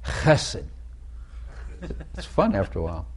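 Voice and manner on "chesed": Chesed with a harsh scraping ch, like German ach; guttural Hebrew. Shown as a demonstration. Showing how guttural Hebrew Chesed should sound. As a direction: push air through the throat on Chesed. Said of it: "You have to be guttural".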